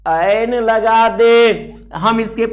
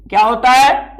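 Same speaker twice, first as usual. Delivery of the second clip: ordinary talk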